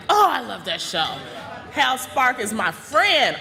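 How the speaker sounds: normal talking voice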